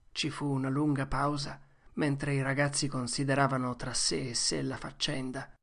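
ordinary delivery

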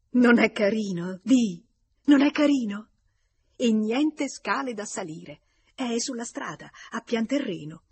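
high, loud burst of delight, easing slightly; enthusiastic. A narrator's reading. Clotilde showing her lover the new flat, enthusiastic. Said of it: "Era entusiasta".

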